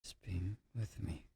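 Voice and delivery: ordinary talk, nothing marked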